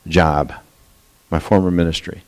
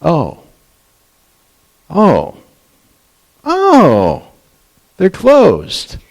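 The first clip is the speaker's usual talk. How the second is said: much higher, each oh rising into stunned understanding; dawning realization; 8 semitones higher, much slower than usual. Retold or imitated. Preacher realizing God closed the doors, stunned rising oh. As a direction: let it slowly sink in, amazed. Realizing his prayer was answered.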